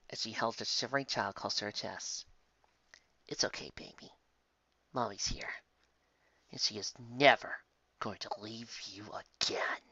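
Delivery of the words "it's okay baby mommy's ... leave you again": hushed, soothing mother's whisper; mother soothing her sobbing daughter softly; tender mother. Acted. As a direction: hold her close and calm her. Mother comforts crying child.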